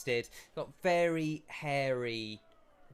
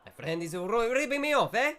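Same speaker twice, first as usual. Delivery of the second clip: louder, higher, fast mock Italian shopkeeper; shopkeeper accent; 10 semitones higher, 6 dB louder than usual. Shopkeeper brushes off customer, louder fast mock Italian accent.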